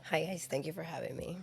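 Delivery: raspy voice. Real, not acted. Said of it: "I'm having a raspy voice day today".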